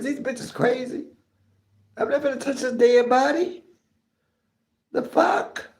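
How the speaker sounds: high, incredulous retold thought; inner outburst. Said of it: "I was saying to myself".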